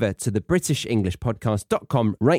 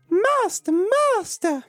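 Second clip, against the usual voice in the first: very high, calling out in alarm; crying harp; slower than usual. Acted. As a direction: shriek for help like a magic harp. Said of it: "the harp cried".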